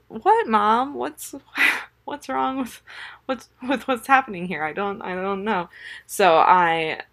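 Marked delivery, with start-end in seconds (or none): 0.1-5.7 put-on high-pitched little-kid voice; imitated toddler. Toddler answering his yelling mom, imitated child voice. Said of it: "He turned to me with his bloodshot eyes".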